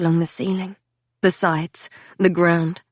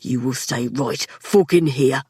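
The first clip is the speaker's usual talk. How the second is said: stern man voice; 6 semitones lower than usual. A narrator's reading. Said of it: "The look he gave me was stricken".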